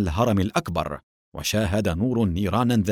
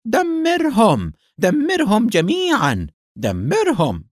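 high, loud, drawn-out battle cry; shouted command; 12 semitones higher, 5 dB louder, slower than usual. A narrator's reading. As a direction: order your partner to destroy, at full cry. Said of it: "وهتف به". (هتاف آمر بصوت عالٍ)

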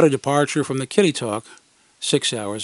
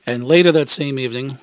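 usual speaking voice

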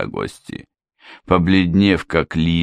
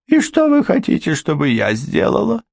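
high, resigned housekeeper; resigned question; 14 semitones higher than usual. A narrator's reading. Housekeeper asking Ford what to do, high resigned. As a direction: ask what they want, giving in.

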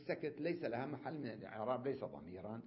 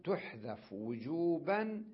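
تحذف وجوبا said slowly, each word drawn out; slow emphatic reading; 3 semitones higher, much slower than usual. Shown as a demonstration. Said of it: "قال". (قراءة بطيئة مؤكدة لـ تحذف وجوبا)

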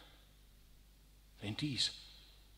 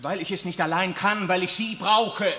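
urgent plea; 5 semitones higher, 7 dB louder than usual. Acted. Riccardo pleads urgently.

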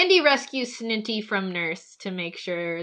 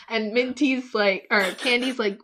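normal talking voice

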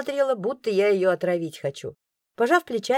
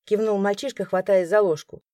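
ordinary delivery

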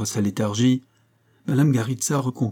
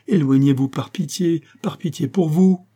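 high, loud imploring, rising to a cry; desperate plea; 6 semitones higher, 4 dB louder than usual. A narrator's reading. Infected woman to her rescuers, desperate plea. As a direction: implore them for their own sake. Begging rescuers to move away.

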